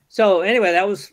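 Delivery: ordinary delivery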